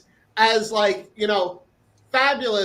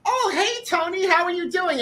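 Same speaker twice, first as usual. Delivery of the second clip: mock eager greeting; 9 semitones higher than usual. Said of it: "and is like".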